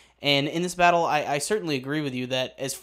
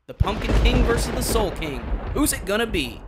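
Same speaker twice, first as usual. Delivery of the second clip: announcer hype; 6 semitones higher, 6 dB louder than usual. Acted. Hyped matchup announcement.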